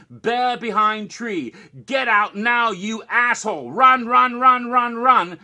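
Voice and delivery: starts firm and slow, rising to loud, high repeated yelling; mock warning signs, building to a shout. Reading escalating warning signs aloud.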